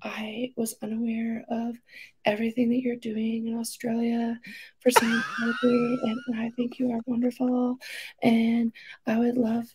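grovel meekly, near a whisper; sheepish apology. Retold or imitated.

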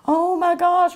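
put-on high, frightened little-kid exclamation; a child who is terrified at a ghost story; scared kid gasp. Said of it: "just looking like".

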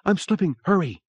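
high, urgent cry for help; panicked plea. A narrator's reading. Woman slipping through broken stairs.

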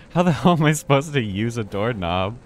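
baffled complaint at the game; exasperated. Real, not acted. Baffled by the game's doorknob.

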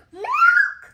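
milk yelled high and loud; excited shout. Real, not acted. Child shouting the word.

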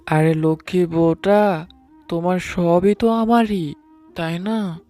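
ordinary delivery, nothing marked

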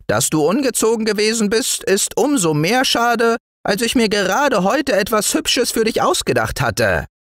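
very high, brisk gnome voice; tiny woodland man, chiding; regretful little dwarf. Acted. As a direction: reproach the boy in a piping voice. Dwarf figure regretting the spoilt plan.

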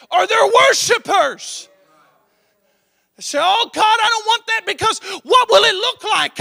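high, loud, driving sermon cry; full-force preaching. Real, not acted. Pastor urging young believers, loud preaching. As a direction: preach it hard and rising.

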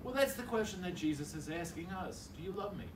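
ordinary talk, nothing marked

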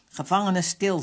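loud, curt shouted command; shouted order. A narrator's reading. Captain silencing his prisoners, shouted order. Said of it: "schreeuwde hij".

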